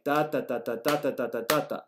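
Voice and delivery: "da, da, da" beating out a sentence rhythm; rhythmic "da" syllables with regular stressed beats; stress-timed rhythm. Shown as a demonstration. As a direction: tap out the English stresses on "da". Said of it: "stressed at regular intervals".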